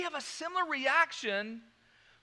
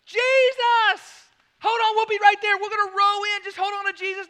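high, loud eager shouting; excited calling; 10 semitones higher, 8 dB louder than usual. Retold or imitated. Peter calling to Jesus from the boat, loud and eager. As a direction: wave and call out eagerly. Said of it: "He doesn't wave".

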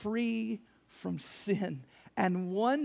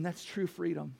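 ordinary delivery

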